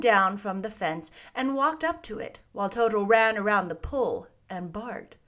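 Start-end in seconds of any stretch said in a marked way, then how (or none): none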